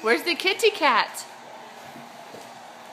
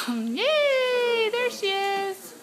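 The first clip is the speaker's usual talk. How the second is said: excited; 7 semitones higher, much slower than usual. Real, not acted. Cheering the baby.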